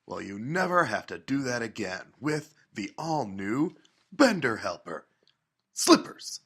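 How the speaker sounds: hammy TV pitchman voice; infomercial. Acted. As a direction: sell it like a late-night ad. Mock ad read after break.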